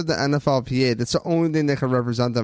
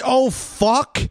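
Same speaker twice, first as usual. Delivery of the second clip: a quick startled curse, higher; mock panicked curse; 9 semitones higher than usual. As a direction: blurt it like a rattled quarterback. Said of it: "the side that you see".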